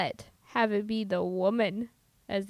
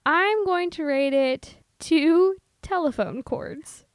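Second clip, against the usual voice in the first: slow emphatic; 3 semitones higher, 5 dB louder, slower than usual. Real, not acted. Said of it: "deliberate rating announcement".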